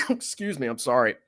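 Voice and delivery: choking on water, coughing; sputtering after water down wrong pipe. Real, not acted. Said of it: "Water went down the wrong pipe".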